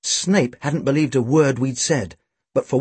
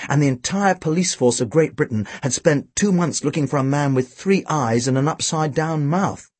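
ordinary delivery

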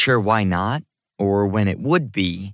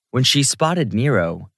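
ordinary delivery

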